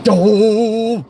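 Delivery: high, drawn-out exasperated groan; long annoyed grunt. Retold or imitated. Actor reading "annoyed grunt" in the script, long drawn-out grunt. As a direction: stretch the vowel right out. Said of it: "it said annoyed grunt".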